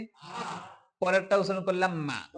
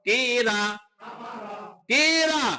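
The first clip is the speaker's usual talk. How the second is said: loud drill call; 3 semitones higher, 5 dB louder, much slower than usual. Shown as a demonstration. Teacher calling syllables for pupils to repeat, loud. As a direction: call it out for the class, building louder.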